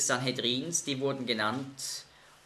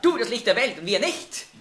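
indignant mock retort, louder; mocking the Pharisees; 6 semitones higher, 4 dB louder than usual. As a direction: scoff as the offended judges. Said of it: "das ist natürlich die Reaktion".

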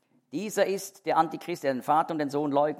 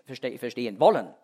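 ordinary talk, nothing marked

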